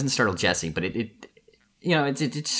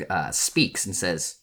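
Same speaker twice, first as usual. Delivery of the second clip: normal talking voice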